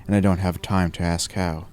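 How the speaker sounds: ordinary delivery, nothing marked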